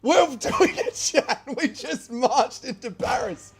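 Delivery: high, excited laughing disbelief; laughing with joy. Real, not acted. Streamer stunned his army got into Paris, high laughing joy. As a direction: cackle through the words, thrilled it worked.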